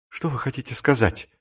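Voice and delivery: hushed, fearful question; frightened. A narrator's reading. Clerk backing away from his accomplice, asking in fear. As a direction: ask it softly, shrinking back. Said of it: "спросил Маковой в страхе".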